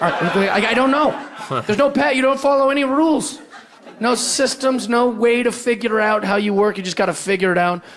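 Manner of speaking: high-pitched flustered rant; mock flustered rant. Man mocking his own confusion at women, pitched high.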